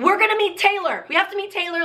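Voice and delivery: high, urgent retold plea; excited urgency. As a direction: act out pleading excitedly with security.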